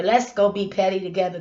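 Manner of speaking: ordinary talk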